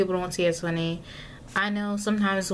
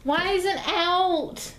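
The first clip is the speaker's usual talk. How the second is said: much higher, slow dismayed whine; high exasperated whine; 10 semitones higher than usual. Real, not acted. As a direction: whine at the mess. Exasperated at the mess.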